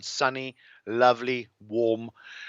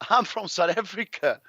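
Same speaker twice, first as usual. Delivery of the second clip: pitched-up laughing indignation; mock protest; 10 semitones higher than usual. Real, not acted. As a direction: protest it with amused outrage.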